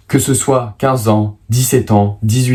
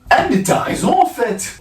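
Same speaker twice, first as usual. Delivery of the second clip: surprised; 10 semitones higher than usual. Acted. Mock realization after math joke.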